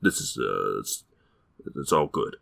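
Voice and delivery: low, fading embarrassed apology; sheepish mumble. Retold or imitated. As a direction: mumble it away, trailing off. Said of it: "And then he's like".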